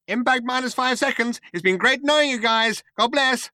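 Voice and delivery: very high, cheery sign-off; high-pitched computer announcement; chirpy computer. A narrator's reading. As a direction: bid the crew a perky farewell. Computer's cheery farewell before impact.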